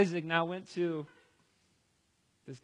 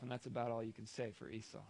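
ordinary talk

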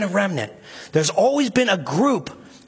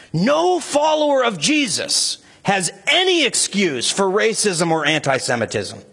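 higher, intense preaching with rising indignation; impassioned outrage; 6 semitones higher than usual. Real, not acted. Preacher condemning antisemitism in the church, impassioned and outraged. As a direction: rebuke the congregation with burning conviction.

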